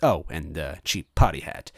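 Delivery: ordinary delivery, nothing marked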